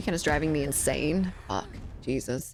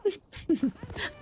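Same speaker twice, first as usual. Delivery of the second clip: high giggling laugh; sudden squeaky laugh of delight; burst of high laughter; 12 semitones higher than usual. Real, not acted. Laughing at a teammate's margarine joke, high giggle.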